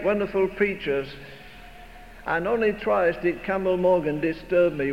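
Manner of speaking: normal talking voice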